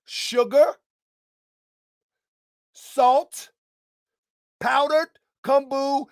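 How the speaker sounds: each item drawn out for the audience to repeat; call and response. Shown as a demonstration. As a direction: lead the crowd through the list. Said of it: "say it with me now".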